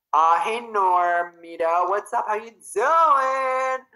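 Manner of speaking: high, drawn-out, sing-song hello; bubbly slow hello; excited greeting. Real, not acted. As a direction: stretch the greeting out happily. Greeting a viewer by name.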